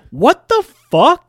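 high, loud, drawn-out outburst; shocked disbelief. Acted. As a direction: stretch it out in stunned disbelief. Acted shock at the answer.

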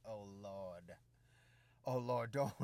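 soft, low, held-back prayer to himself; quiet plea. Retold or imitated. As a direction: pray under your breath, trying not to laugh. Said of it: "And I'm like".

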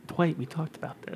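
quiet, soft-spoken impression; Toby. Fucking Toby correcting Dwight about the bird, softly imitated. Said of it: "that's how Toby talks".

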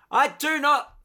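loud high indignant reply; shouted reply. Retold or imitated. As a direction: yell it back across the dam. Acted reply across wall.

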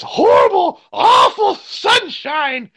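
high, slow witchy wail; witch voice. Retold or imitated. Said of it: "I'll go".

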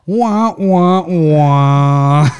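put-on high toddler wah wah; imitating a toddler's wah wah. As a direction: mimic a little kid crying wah. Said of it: "Zeke going".

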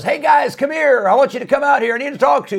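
high, loud, eager summons; king calling out. Retold or imitated. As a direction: call them out of the furnace like a king. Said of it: "Nebuchadnezzar says".

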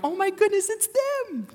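high-pitched gushing squeal; wife fangirling. Retold or imitated. Pastor mimics his wife spotting someone famous, fangirling. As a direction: imitate a starstruck fan, voice shooting way up. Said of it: "my wife calls it fangirling".

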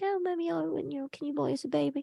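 soft, high-pitched child imitation; sweet little girl pleading. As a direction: mimic a small daughter sweetly begging mommy. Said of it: "little Annie's so sweet. She's like".

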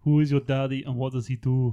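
put-on light Austrian accent, film tough guy; Arnie impression. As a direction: growl it like an Austrian action star. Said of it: "Do your Arnie impression".

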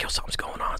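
hushed, voiceless mutter; furtive aside. Retold or imitated. Recruit sneaking a word to the others, furtive whisper. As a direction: imitate a recruit talking under his breath.

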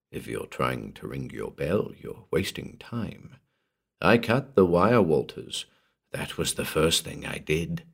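low, very soft menace; gentle voice. A narrator's reading. Morden telling trapped Walters the bell is useless, gentle. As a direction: explain it gently and quietly. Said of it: "The gentle voice came down".

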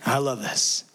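ordinary talk

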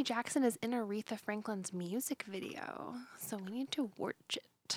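hushed, breathy conspiratorial whisper. Acted. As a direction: lean in and whisper it. Conspiratorial aside about the video.

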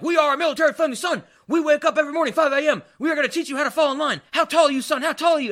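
loud, high-pitched drill-sergeant yelling; screaming military dad. Retold or imitated. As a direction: bark it like a military father drilling his new son. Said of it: "he's, like, screaming at him".

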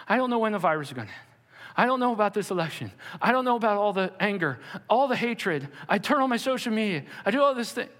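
quick, high-strung anxious voice; anxious worrier. Retold or imitated. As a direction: rattle off fears like a panicked believer. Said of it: "Some of you are going".

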